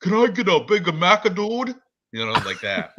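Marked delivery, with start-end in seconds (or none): 0.0-1.8 much higher, louder surfer-dude voice. Retold or imitated.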